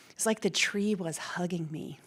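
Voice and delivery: soft unvoiced whisper, slowing down; tender whispered memory. Real, not acted. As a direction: hush it like sharing a secret. Fond memory of her tree spot.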